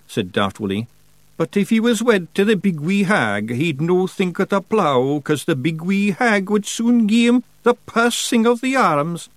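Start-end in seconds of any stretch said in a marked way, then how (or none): 1.4-9.3 high Scottish Feegle voice; eager simpleton. A narrator's reading. Daft Willie reasons about marrying the hag, eager. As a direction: offer a naive theory, pitched up.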